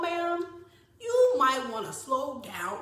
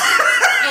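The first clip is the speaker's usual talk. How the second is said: short burst of laughter; 12 dB louder than usual. Real, not acted. Laughing mid-story.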